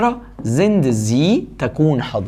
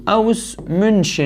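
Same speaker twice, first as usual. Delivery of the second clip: slow dictation; 6 semitones higher, much slower than usual. Shown as a demonstration. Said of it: "slow dictation of the answer".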